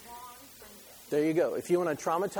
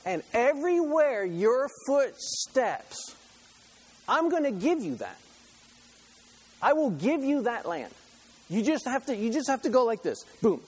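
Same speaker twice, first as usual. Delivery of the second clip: voicing God; 7 semitones higher, 4 dB louder than usual. Preacher voicing God offering the land, emphatic and raised. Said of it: "this is how he says it".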